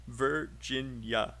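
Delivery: slow three-beat Virginia; broken into syllables. Shown as a demonstration. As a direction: say Virginia at half speed, one syllable at a time. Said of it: "Break it out and then say three".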